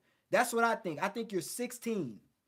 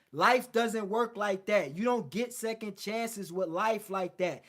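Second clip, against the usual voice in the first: ordinary talk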